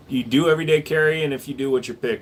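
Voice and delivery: ordinary talk, nothing marked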